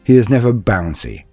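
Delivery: ordinary delivery, nothing marked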